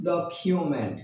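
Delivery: "ডকুমেন্ট" said as "dok-kyu-ment"; correct pronunciation. Shown as a demonstration. Teacher giving learners the proper form, "ডকুমেন্ট" as dok-kyu-ment. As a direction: say "ডকুমেন্ট" with an aspirated "kyu" in the middle. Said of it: "কি হবে?".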